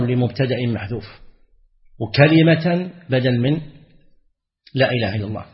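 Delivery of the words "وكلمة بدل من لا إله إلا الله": ordinary delivery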